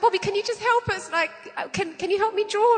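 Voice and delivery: mock begging child; pleading kid voice. Kids pleading, voiced in story.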